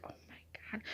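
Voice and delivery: barely audible, whispered reaction; hushed, voiceless retold gasp of words; awed whisper. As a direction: whisper it to yourself in shock. Retelling her frightened reaction.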